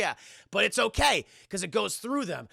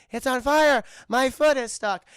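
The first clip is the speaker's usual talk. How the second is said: unconvincing alarm; 6 semitones higher, 7 dB louder than usual. Retold or imitated. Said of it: "No, she's like".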